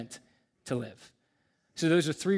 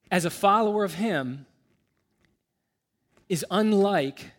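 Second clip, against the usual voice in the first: preaching at full force; 3 semitones higher, 4 dB louder than usual. Real, not acted. Pastor pressing his point on the congregation, loud, rising preaching. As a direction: drive the point home to the room.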